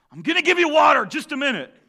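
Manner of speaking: high, loud, impatient reply; exasperated Moses. Retold or imitated. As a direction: call out to the crowd, fed up. Moses answers the crowd.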